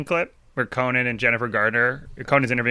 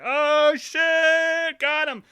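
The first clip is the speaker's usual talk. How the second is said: very high mock crowd cry; high hammed-up oh shit got him; crowd reaction; much slower than usual. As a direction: squeal it like the whole audience. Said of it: "everyone in the crowd's like".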